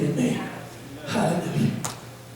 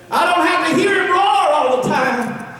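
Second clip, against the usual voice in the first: preaching at full force; 9 semitones higher than usual. Acted. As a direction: build it like a revival preacher.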